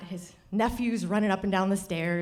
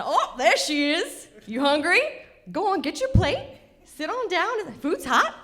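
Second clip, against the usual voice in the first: higher, loud hospitable host calling her over; imitating a hearty welcome; hearty father-in-law welcome; 8 semitones higher, 5 dB louder than usual. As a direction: call her to the table, big and high.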